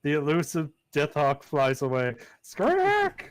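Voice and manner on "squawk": very high screech; bird squawk. Acted. GM voicing the departing deathhawk, piercing squawk. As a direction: shriek like a hawk leaving.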